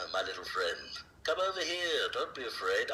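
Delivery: reassuring Alec Guinness impression; Obi-Wan Kenobi. Shown as a demonstration. Voice actor as Obi-Wan calling over a frightened little one, reassuring. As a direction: coax gently in the old Jedi's lilting voice. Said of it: "I can talk like Obi-Wan Kenobi or Alec Guinness".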